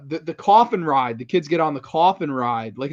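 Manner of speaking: ordinary talk, nothing marked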